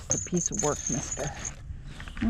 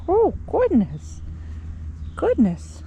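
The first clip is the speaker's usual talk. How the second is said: delighted; 9 semitones higher, slower than usual. Real, not acted. Delighted cat on back.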